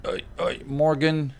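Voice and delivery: ordinary delivery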